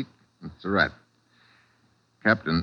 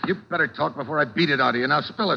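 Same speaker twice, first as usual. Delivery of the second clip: threatening; 5 semitones higher than usual. Acted. Detective to Surratt, higher and threatening. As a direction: squeeze him until he talks.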